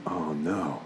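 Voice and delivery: soft low dismayed groan; quiet dread at the pouting toddler. Retold or imitated. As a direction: realize you're caught, under your breath. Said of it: "I was like".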